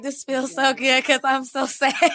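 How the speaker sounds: laughing confession. Retold or imitated. Admitting comfort, laughing.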